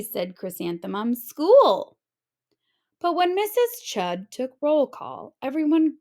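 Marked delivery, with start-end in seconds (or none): 1.2-2.0 high loud delighted cry; happy cheer. A narrator's reading. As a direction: shout it out gleefully. Child cheering off to school.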